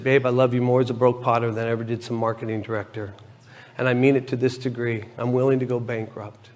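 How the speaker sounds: soft, low, earnest reassurance; retold tender pledge. Retells wife's tender, earnest pledge.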